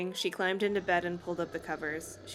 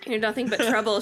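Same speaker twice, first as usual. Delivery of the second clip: mildly angry, louder narration; a touch of anger, louder; angry thought; 3 semitones higher, 5 dB louder than usual. A narrator's reading. Chris fed up with the dummy, mildly angry. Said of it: "she thought angrily".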